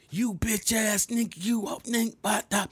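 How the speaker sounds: high mock screaming girlfriend; angry girlfriend. Girlfriend cussing him out, high mock rage. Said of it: "I'm like".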